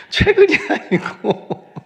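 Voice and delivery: laughing loudly through the words; amused, laughter breaking into speech. Real, not acted. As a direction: burst out laughing mid-sentence, drawn out. Laughs at own old confession.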